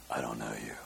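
very low, hushed gravel growl; whisper-growl it like Batman. Pretend. Preacher's spirit reply that came out like Batman. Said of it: "that's more like Batman".

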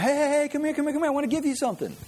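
high, wheedling sales pitch call; pushy salesman. Retold or imitated. Preacher mimicking a store salesman calling customers over. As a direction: lure him over like a hawker.

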